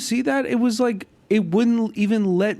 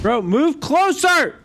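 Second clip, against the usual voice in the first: shouting; 9 semitones higher, 4 dB louder than usual. Real, not acted. Yelling at teammate mid-fight.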